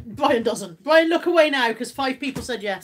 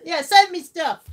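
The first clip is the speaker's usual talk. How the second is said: playful demand; 6 semitones higher, 8 dB louder, much slower than usual. Pretend. Jokey greedy aside.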